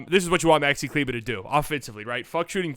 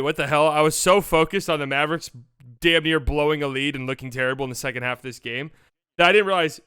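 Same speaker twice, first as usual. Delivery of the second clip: ordinary talk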